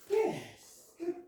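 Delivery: high, bright marker "Yes" then praise. Real, not acted. Trainer rewarding the dog for sitting, high and excited.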